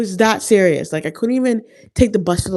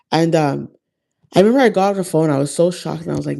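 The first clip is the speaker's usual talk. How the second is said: ordinary talk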